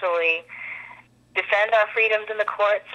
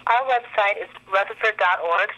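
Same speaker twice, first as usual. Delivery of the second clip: normal talking voice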